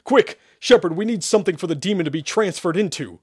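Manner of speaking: high, loud hurried command; urgent order. Acted. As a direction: hurry him along. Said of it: "Sherman ordered".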